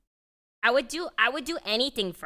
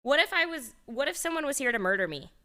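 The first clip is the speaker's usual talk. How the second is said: mock scolding; 2 semitones higher, 5 dB louder than usual. Real, not acted.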